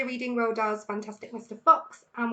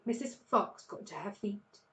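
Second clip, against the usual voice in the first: ordinary delivery, nothing marked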